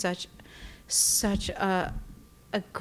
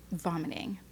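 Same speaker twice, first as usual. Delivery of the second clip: whispered; 3 semitones lower, 9 dB quieter than usual. Retold or imitated. Recalling nausea on couch.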